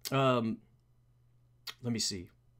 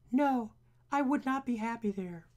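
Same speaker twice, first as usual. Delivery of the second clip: much higher put-on voice; story character refusing; 5 semitones higher than usual. Character answers Bruce's invitation.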